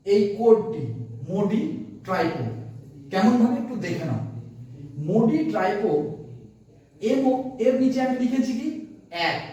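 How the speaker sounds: ordinary talk, nothing marked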